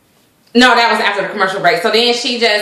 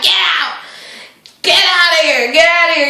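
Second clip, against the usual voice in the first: mock angry get out; 8 semitones higher than usual. [name] throwing Alex out.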